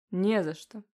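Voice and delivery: ordinary delivery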